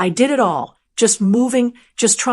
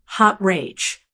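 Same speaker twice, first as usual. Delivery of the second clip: ordinary delivery, nothing marked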